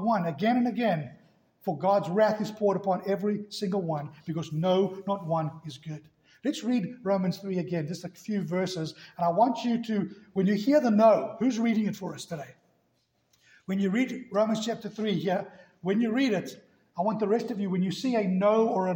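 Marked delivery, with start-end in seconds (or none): none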